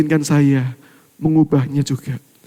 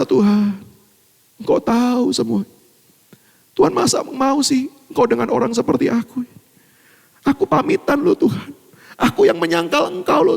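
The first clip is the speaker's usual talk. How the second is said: rising, pleading plea to God; pleading prayer; 9 semitones higher than usual. Retold or imitated. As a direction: beg God with a breaking voice. (doa memohon dengan suara tinggi)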